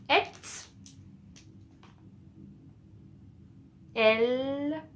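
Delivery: "Et", "L", "R" one by one as ette, elle, ère; sounds for repeating. Shown as a demonstration. Teacher drills the three sounds, "Et", "L", "R" each said separately. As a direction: isolate "Et", "L", "R" as ette, elle, ère, with long pauses. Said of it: "redis une fois".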